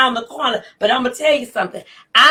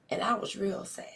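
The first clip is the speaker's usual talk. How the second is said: whispered sadness; 4 semitones lower, 16 dB quieter, faster than usual. Real, not acted. Confessing her sadness after anger.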